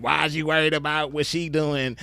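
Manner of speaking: lightly imitated nagging question; lightly mock a woman's objection; mocking women's talk. Man imitates women dodging accountability, light mockery. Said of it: "that's how the women talk".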